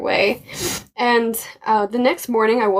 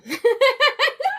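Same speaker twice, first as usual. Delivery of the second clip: high burst of laughter; a high giggle at her own silliness. Real, not acted. Laughing at her own mistake to a friend, high giggle.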